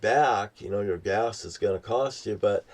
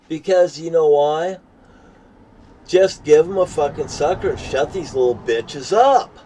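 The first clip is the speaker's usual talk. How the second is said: loud rant; 5 semitones higher, 6 dB louder than usual. Real, not acted. Ranting about people without teeth.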